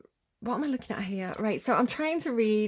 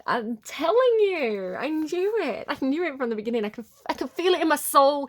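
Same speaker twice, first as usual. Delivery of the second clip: excited vindication; 6 semitones higher, 5 dB louder than usual. Pretend. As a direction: crow it, you knew it all along. Exclaims reading is confirmed, echoing accent line.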